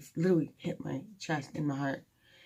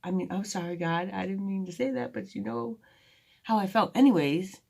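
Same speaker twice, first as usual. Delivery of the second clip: mock venting; 5 semitones higher, 4 dB louder than usual. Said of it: "I'd be like".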